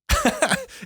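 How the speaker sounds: a sudden squeaky laugh; high burst of laughter. Real, not acted. Laughing at the memory of a friend crying. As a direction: let a high giggle break out.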